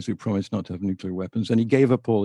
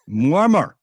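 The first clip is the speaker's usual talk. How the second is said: louder, higher mock announcement; caller giving his name; 5 semitones higher, 5 dB louder than usual. Caller naming himself to the host, mock proud foreign voice. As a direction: drop the name like a bombshell. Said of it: "could you tell me your name".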